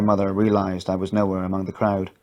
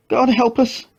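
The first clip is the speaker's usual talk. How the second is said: much higher, anguished repeat; despairing prayer; 14 semitones higher, 3 dB louder, slower than usual. A narrator's reading. Mother repeats prayer in despair, much higher anguish. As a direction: plead to God again.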